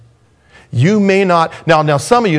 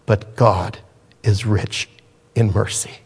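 "but God" said much softer and lower; hushed; 6 semitones lower, 5 dB quieter than usual. Shown as a demonstration. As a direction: let "but God" fall to a near hush, reverent. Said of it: "repeated softer".

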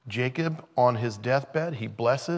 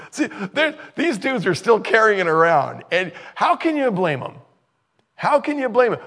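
high, loud, laughing disbelief at the brothers; rising amazement, half laughing; excited preaching; 10 semitones higher, 6 dB louder than usual. Real, not acted. Preacher marvels to congregation, high and loud.

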